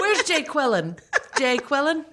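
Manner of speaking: "Jay Quillen" as two names, jay-KWIL-in, pitched high; mispronounced name. Retold or imitated. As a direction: call roll, misreading Jacqueline as "Jay Quillen". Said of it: "where they say the names wrong".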